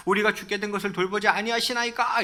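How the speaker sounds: high-pitched acted complaint; reproachful plea. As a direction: plead as disciples with some resentment. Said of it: "이거 약간 원망 섞인 어투죠". (약간 원망 섞인 높은 목소리로)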